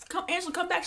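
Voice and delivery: high, imitated plea; mock calling back. Castmates beg Angela to return, mock calling.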